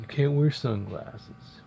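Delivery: a hint of a bossy coworker, slow. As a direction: lightly mimic a know-it-all, slowly. Voicing bossy coworker again.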